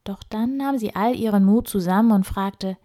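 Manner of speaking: plain reading voice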